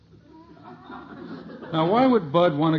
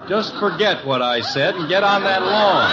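louder half-laughing command; mock stern order; 4 semitones higher, 6 dB louder than usual. Father sends son back to mow, loud and laughing. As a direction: boss him back to work, barely hiding a laugh.

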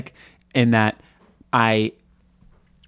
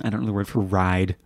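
usual speaking voice

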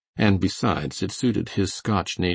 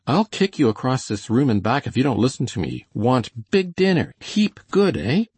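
high, loud exasperated threat; 6 semitones higher, 4 dB louder than usual. A narrator's reading. Coley threatens the cook to get dinner, exasperated. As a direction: scold him, pitch climbing into coaxing pidgin. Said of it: "said the exasperated Coley".

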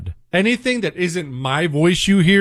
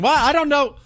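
higher, puzzled mock voice of a lost donor; confused donor; 9 semitones higher than usual. Donor sent to the wrong website, mock confused and higher. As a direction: play the baffled customer who landed on the wrong site. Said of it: "they're all of a sudden".

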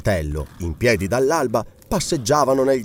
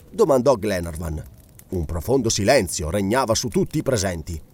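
usual speaking voice

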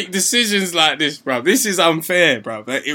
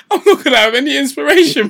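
laughing; 8 semitones higher, 7 dB louder than usual. Real, not acted. Repeats the joke while laughing.

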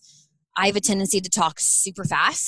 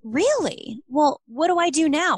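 confused shopper; 7 semitones higher, slower than usual. Retold or imitated. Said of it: "she was like".

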